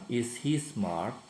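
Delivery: slow, deliberate English question; slow model question. Shown as a demonstration. Teacher shows pupils the moved verb, said slowly. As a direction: spell out the question slowly for the class. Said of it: "pindahkan kata kerjanya ke depan".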